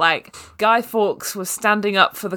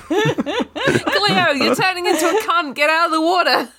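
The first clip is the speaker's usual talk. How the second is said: much higher, laughing Australian voice calling Cleo out; laughing mock Australian; 10 semitones higher than usual. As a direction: yell at Cleo in a silly Aussie voice through laughter. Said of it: "shot-for-shot remake of H two O".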